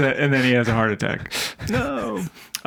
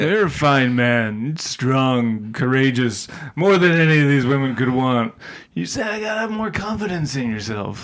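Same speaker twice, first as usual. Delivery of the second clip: imitating a tipsy movie character; drunk bro talk. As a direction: slur it like a boozy bro. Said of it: "sort of drunk bro talk at the bar".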